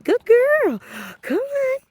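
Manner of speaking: put-on baby talk. Shown as a demonstration. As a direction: encourage the dog forward. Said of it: "I'm gonna tell her she's doing right".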